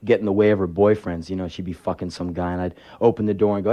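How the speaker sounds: normal talking voice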